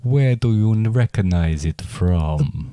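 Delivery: slow, lightly put-on vampire. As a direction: play the vampire guest slowly. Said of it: "my name is Brad".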